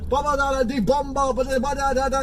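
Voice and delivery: high-pitched babbled mock rant; gibberish rant. Passenger mimicking the taxi driver, gibberish rant. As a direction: imitate an incomprehensible rant in nonsense syllables. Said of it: "so he starts ranting off".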